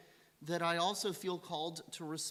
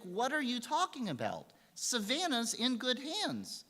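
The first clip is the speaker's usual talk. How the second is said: high-pitched incredulous retelling; puzzled, stretching the last words; acted bafflement; 8 semitones higher than usual. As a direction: act out baffled disbelief, pitch up. Doctor baffled by parents' remark.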